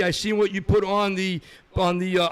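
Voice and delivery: usual speaking voice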